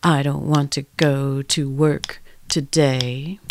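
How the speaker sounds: slow rhythmic spoken line; a slow beat-driven grumble; rhythmic rendition. Shown as a demonstration. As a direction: lay the words out in a slow groove. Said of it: "rhythmic renditions of my morning complaint".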